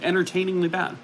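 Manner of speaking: ordinary talk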